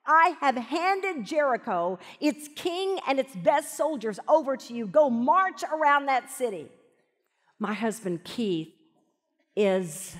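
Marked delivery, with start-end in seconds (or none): 0.1-6.7 commanding voice of God. Acted. God's command voiced in sermon.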